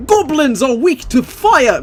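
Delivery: high, hammed-up British shout, drawn out; mock British yell. Player imitating UK-made pawns warning of goblins, mock British yelling. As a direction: yell it like a British game companion. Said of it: "and they will just yell".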